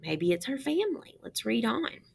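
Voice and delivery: ordinary delivery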